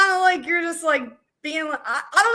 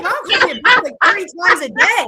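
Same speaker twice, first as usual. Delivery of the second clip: emphatic retort; 8 dB louder than usual. Retold or imitated. She tells puzzled friends about her mom, loud emphatic retort. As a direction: fire it back like it's obvious. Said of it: "I'm like".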